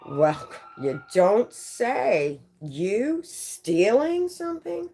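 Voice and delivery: slow, sarcastic, rising high on the question; dripping mock astonishment, slowed right down; sarcastic disbelief. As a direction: feign innocent surprise while knowing the truth. Sarcastic aside about the theft.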